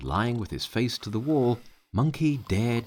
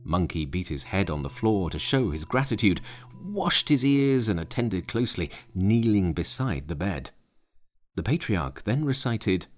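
ordinary delivery

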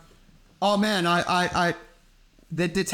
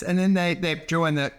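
normal talking voice

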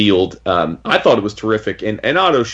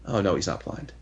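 hushed mock dismay; retold sheepish realisation; 3 semitones lower, 11 dB quieter than usual. Retelling Clint after his hand is grabbed, hushed realisation. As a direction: drop to a small, soft aside.

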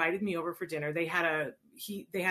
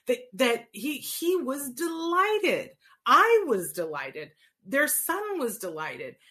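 high and slow, then much louder; delighted; 7 semitones higher, 6 dB louder, slower than usual. Real, not acted. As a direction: savour the word delighted with glee. Gleeful retelling of the happy outcome.

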